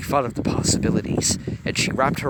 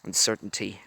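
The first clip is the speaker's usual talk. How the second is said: ordinary delivery, nothing marked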